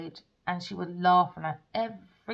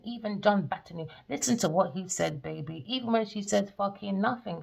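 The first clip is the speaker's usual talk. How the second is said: ordinary delivery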